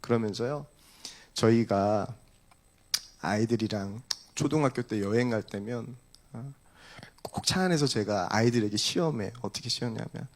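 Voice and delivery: normal talking voice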